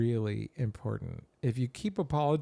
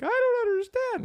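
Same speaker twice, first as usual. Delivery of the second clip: very high-pitched, louder mock daughter; imitated daughter; 5 dB louder than usual. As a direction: mimic the daughter in a squeaky high voice. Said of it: "my daughter was like".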